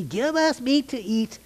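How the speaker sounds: a touch of high whiny pleading; understated whine; mock wailing crowd. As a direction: lightly voice the complaining people. Quoting the wailing people.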